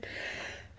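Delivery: sudden stifled sneeze; short muffled sneeze; sneezing. Real, not acted. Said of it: "Je vais éternuer".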